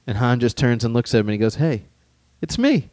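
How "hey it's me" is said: high, cocky hey it's me; cocky Han Solo. Retold or imitated. Han brushing off Luke's warning, cocky. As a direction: toss it off with swagger. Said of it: "I love that attitude".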